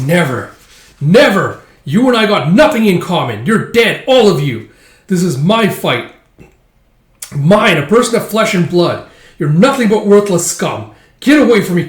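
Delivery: loud, high angry shouting; furious defiance. Acted. Hero rejects the vengeful spirits, furious shouting. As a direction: roar at them to back off.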